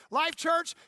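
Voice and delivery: ordinary talk, nothing marked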